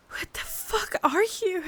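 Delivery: panicked hushed question; high terrified whisper. Acted. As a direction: gasp the question out. Facing the creature.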